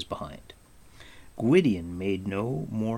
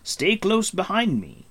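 high, loud, firm command; ordering; 10 semitones higher, 6 dB louder than usual. A narrator's reading. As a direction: give a sharp order to follow. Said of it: "he ordered".

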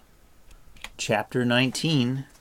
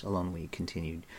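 usual speaking voice

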